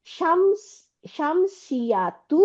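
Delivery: slow spelled-out term. Shown as a demonstration. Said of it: "অর্থটা কি?".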